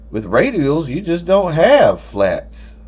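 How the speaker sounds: pompous public-speaking voice; addressing a crowd. A narrator's reading. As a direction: hold forth as if to an audience, slowly. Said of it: "in that voice he uses when he's talking to a number of people at once".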